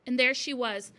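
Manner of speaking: usual speaking voice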